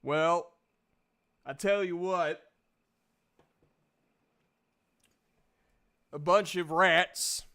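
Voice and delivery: loud, emphatic storytelling voice. Acted. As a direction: announce it with gusto. Character explaining loudly.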